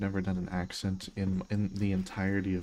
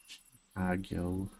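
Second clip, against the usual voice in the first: Chicago drawn out slowly as "kahg-yo", mangled foreign accent; butchered accent; much slower than usual. Shown as a demonstration. Streamer mangling Chicago in a butchered accent when teased by chat. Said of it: "say Chicago in a butchered accent".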